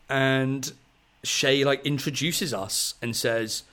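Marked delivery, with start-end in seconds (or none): none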